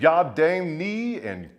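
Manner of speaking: deliberate slow spelling-out delivery; slow careful sounding out. Acted. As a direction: drag out each syllable of the place names. Slowly sounding out Korean place names.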